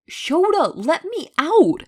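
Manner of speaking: high, loud, slow shout. A narrator's reading. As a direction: yell to be let out, dragging the words. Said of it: "Hisashi shouted".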